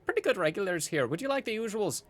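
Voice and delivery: bubbly innkeeper, high exclamation settling into chatter; Gideon. Acted. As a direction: welcome the regulars brightly and take their order.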